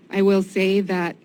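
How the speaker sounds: usual speaking voice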